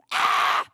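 loud, strangled mock cry; sudden comic yell; squeezed-to-death scream. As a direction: imitate being crushed by a snake. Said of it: "big snake squeezing you to death".

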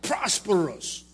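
high, loud, slow preaching build; fervent preaching. Acted. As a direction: hammer it home from the pulpit. Preaching at full force.

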